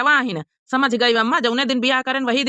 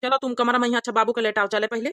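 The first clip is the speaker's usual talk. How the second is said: normal talking voice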